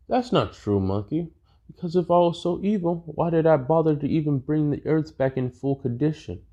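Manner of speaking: a hint of a sneering tyrant; Frieza voice. Frieza answering Goku.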